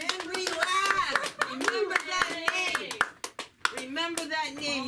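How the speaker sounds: drawn-out host hype of the name; announcer hype. Acted. Host hyping the comedian's name to the crowd, announcer call. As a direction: sell the comic's name to the crowd. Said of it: "host hypes comic's name".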